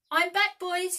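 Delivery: high, loud joyful call; cried out. A narrator's reading. Peter calls to the Lost Boys, high and joyful. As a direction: announce your return with delight. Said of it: "he cried".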